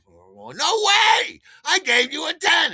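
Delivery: very high, loud repeated scream; screaming protest. Retold or imitated. Buyer drowning out the dealers, screaming protest. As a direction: shout them down at the top of your voice. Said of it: "I just start screaming it".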